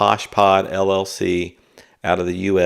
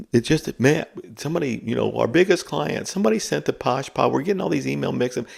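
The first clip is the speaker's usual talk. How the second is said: much higher, fast, complaining voice; high-pitched whiny worker; 8 semitones higher, faster than usual. Retold or imitated. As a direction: squeak out a frazzled office complaint. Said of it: "where someone says".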